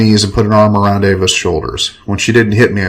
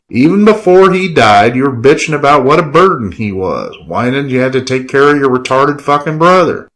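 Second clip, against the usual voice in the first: higher, heated accusation; angry tirade; 5 semitones higher than usual. Acted. Killian cuts Enrique off, angry tirade. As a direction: berate him in a rising temper. Killian slams bottle, berates narrator.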